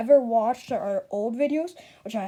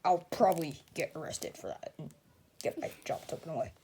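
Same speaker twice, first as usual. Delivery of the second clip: whispered aside; 7 semitones lower, 9 dB quieter, faster than usual. Real, not acted.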